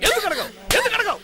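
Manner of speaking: father demanding why she won't apologize, rising; rising angry demand. Acted. Father repeats question, rising.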